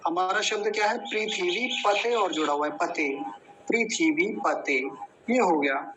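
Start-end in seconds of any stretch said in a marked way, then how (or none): none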